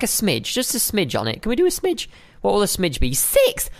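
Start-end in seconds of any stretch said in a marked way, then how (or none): 3.1-3.7 outraged squeak. Real, not acted. Outraged at price.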